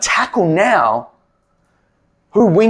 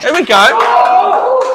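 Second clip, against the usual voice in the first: hyped laughing cheer; 12 semitones higher, 5 dB louder, much slower than usual. Real, not acted. Coach hyped after chant.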